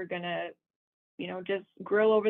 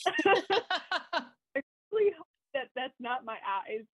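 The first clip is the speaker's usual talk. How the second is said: laughing; 5 semitones higher, slower than usual. Real, not acted. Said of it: "laughs while telling".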